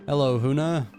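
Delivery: ordinary talk, nothing marked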